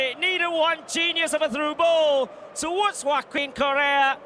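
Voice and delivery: loud, high thrilled commentary; excited shouting. Real, not acted. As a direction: shout it over the crowd. Co-commentator after the winning goal.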